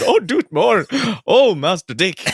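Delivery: high, loud mock-thrilled butler; hammed-up Alfred. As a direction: ham it up as an over-excited servant.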